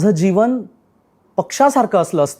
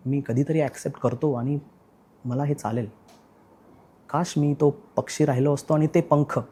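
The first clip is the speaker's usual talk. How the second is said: softer, fading towards a near-silent close; wistful resignation; 3 semitones lower, 5 dB quieter than usual. Acted. Speaker wishing to be a bird, wistful and resigned. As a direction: trail away quietly, wishing it were so. Monologue ends on wistful wish.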